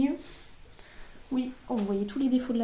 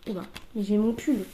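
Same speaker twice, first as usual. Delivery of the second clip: ordinary talk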